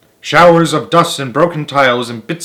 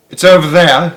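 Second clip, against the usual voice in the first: high, loud pointing it out; lightly voiced excited boy; eager child; 6 semitones higher, 8 dB louder than usual. A narrator's reading.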